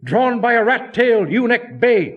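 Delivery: loud, high call to clear the road; a crowd yelling as the shay rolls past. Acted. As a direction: shout it down the street at the small boys. Boys calling out at passing shay.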